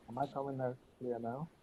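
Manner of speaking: very faint and quick; muffled voice on a bad line; faint mic. Real, not acted. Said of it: "Speak a little bit louder".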